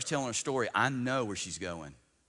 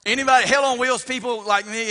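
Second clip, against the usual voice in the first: high, loud shout to the crowd; rousing preacher's call; 12 semitones higher, 14 dB louder than usual. Real, not acted. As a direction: holler the question out to the whole congregation. Calls out to the congregation.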